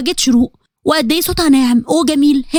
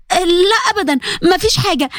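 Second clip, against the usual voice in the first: higher, hurried cover-up; quickly paper over it, pitched up; Nour covering up; 4 semitones higher than usual. Acted. Nour covers for Huda in front of Zein, higher voice.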